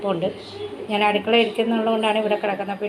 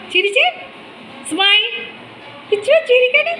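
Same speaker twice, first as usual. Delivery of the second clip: put-on high, sing-song, louder baby talk; high pet-talk voice; 6 semitones higher, 4 dB louder than usual. Owner playfully scolds her dog in high baby talk. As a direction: tease the dog like a small child.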